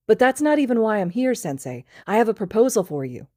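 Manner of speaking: fast, high eager chatter; tipsy rush. Acted. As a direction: rush to the point, excited. Drunk student rushing his request.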